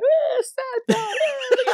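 high whimpering sad-song wail; mock crying voice. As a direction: whine it like a weepy ballad. Mocking sad songs.

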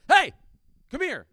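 acted alarmed parent's yell; urgent parental shout. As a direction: call out sharply to a child running off, high and loud. Parent shouting at kids in street.

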